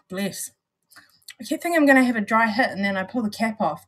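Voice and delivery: ordinary talk, nothing marked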